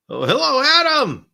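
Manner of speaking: light up as a friend walks in; excited greeting. Real, not acted. Streamer welcoming a viewer into the chat, delighted high hello.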